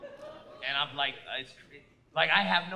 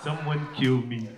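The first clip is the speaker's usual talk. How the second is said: low, defeated mumble of a beaten-down husband; 7 semitones lower than usual. Retold or imitated. Husband at the grocery store, low and defeated. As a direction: sigh it out like a broken man. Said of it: "he just goes".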